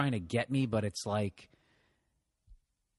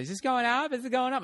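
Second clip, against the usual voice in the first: imitating old lady; 12 semitones higher than usual. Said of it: "She says".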